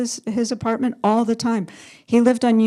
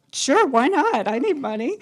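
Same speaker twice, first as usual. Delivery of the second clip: sing-song, higher-pitched retold reply; breezy acceptance; 5 semitones higher than usual. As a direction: lilt it up and down, airily agreeing. Broke artist accepting job offer, retold.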